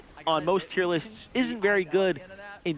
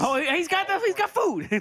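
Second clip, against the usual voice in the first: excited. Real, not acted. Amused at streamer eating.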